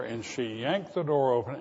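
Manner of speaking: usual speaking voice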